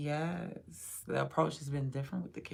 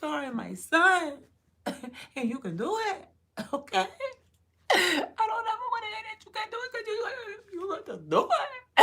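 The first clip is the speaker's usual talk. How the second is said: high, fast, laughing through the encouragement; laughing, tearful cheer; 6 dB louder than usual. Retold or imitated. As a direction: cheer while cracking up. Reenacting cheering on his son.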